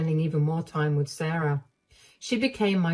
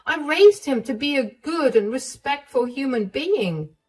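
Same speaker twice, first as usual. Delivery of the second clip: higher and louder, indignant; outraged mother; 9 semitones higher, 4 dB louder than usual. Acted. Mother furious at her son for hurting Emma, higher and louder. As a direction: let the anger burst out.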